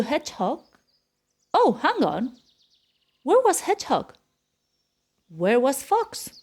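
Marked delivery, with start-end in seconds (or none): none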